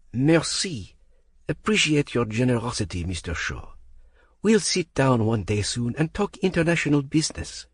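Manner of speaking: put-on warm-sounding French accent with cold eyes; a French accent, polite warmth that isn't meant; falsely warm French accent. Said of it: "none of the warmth in his tone".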